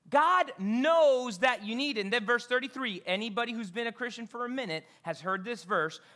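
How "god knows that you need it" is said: God punched loud and slow; louder, slower emphatic declaration; stress on God. Real, not acted. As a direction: hammer home God knows. Pastor stressing the point.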